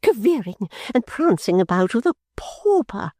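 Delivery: high, sneering remark; Fanny mocking her sister; scornful jibe. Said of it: "exclaimed the irrepressible Fanny".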